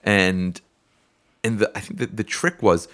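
usual speaking voice

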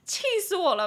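high, comic outburst of anger; worked-up, pitched-high fuming; exasperated. Real, not acted. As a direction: blurt out total exasperation.

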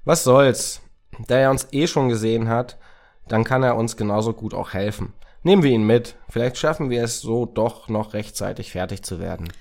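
loud, high voice of a decisive sidekick; Racket, resolved. Acted. Racket persuades Samira to take the boy along, emphatic. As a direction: push your partner to agree, loudly.

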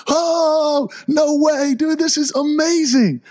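celebrating like you just met your hero; going nuts. Retold or imitated. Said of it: "Charlie and I went nuts".

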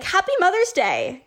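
high, singsong imitated phone greeting; cheery greeting. Couple phoning mother-in-law with good wishes, cheery greeting.

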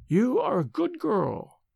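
put-on stern but kind old crone; ancient nurse. A narrator's reading. Old nurse to the frightened maid, stern and slow.

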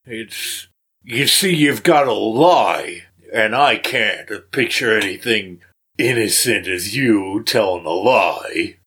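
slow, drawling tavern guard; scarred guard. Acted. Scarred guard condescending to elf at dice table, slow drawl. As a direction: lean in and draw the words out slowly. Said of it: "kind of leans over and is like".

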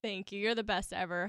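ordinary delivery

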